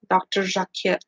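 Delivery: Doctor Jacquet said the French way, with a soft zh; a light French accent on Jacquet; Doctor Jacquet with a hint of French. Shown as a demonstration. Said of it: "Say it with an accent every time".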